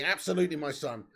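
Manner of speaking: ordinary talk, nothing marked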